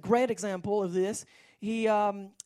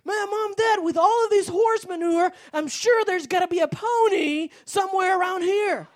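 put-on excited boy; 9 semitones higher, 6 dB louder than usual. Boy explains joyfully.

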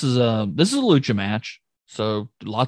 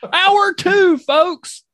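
announcer call; 5 dB louder, much slower than usual. Pretend. Announcing the second hour.

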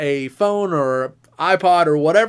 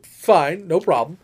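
ordinary delivery

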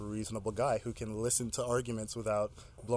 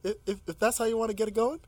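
mock challenge; 8 semitones higher than usual. Host playfully escalating against guest.

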